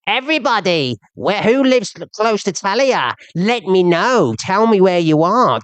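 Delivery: sneering impression of a live call-out; imitating E Q. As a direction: pitch it up and drag out "let me know". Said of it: "she goes".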